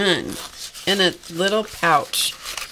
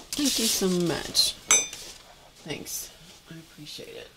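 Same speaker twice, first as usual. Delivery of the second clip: holding back tears as the voice drops to almost nothing; real tearful gratitude, barely voiced; near tears; 7 dB quieter than usual. Real, not acted. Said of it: "I could tell it was getting ready to go south".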